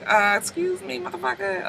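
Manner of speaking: high, hushed mock indignation; quietly affronted. Marcus looks at him, offended, high and soft. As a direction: say it small and high, as a silent objection. Marcus's look after being slighted.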